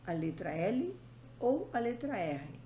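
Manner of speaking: normal talking voice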